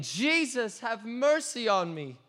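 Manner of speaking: high, pleading cry from the ground; crying out again. Retold or imitated. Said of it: "And I cried out again".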